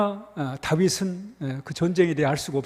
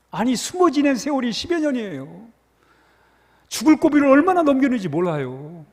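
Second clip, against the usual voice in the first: higher, louder preaching build; fervent preaching; 7 semitones higher, 3 dB louder than usual. Acted. Pastor urging the congregation to see David's tears, fervent preaching. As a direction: drive the point home with rising passion.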